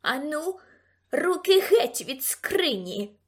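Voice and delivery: imperious, drawn-out order; commanding tone. A narrator's reading. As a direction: order them off with authority. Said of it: "наказала вона несподівано владним тоном".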